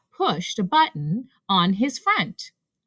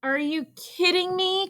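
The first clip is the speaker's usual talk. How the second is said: high-pitched disbelief; high, exasperated questioning; incredulous cry; 9 semitones higher, 4 dB quieter than usual. A narrator's reading. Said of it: "cried Rabbit".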